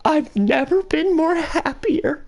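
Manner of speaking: high, slow gleeful gushing; overjoyed. Retold or imitated. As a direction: gush with delight. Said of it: "I'm like".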